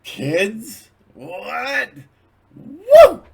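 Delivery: very high, loud shocked yelp; shrieking startled Kramer; Kramer impression. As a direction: go full Kramer freakout. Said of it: "I'm imitating Kramer there".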